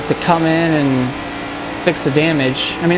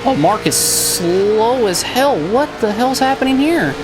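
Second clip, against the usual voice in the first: raging gamer; 6 semitones higher than usual. Real, not acted. Racing game chaos, shouting.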